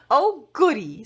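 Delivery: loud, high little cheer; excited. A narrator's reading. Magnolia delighted about presents, high happy cheer. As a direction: squeal it with delight. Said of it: "said Princess Magnolia".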